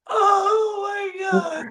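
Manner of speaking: high, drawn-out near-wail of amusement; overcome with laughter. Real, not acted. Reacting to the running clip, overcome with laughter. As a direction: stretch it slow and high, helpless with laughter.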